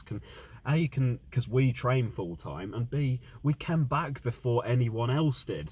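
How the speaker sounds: angry rant. Real, not acted. Fan ranting about team's loss.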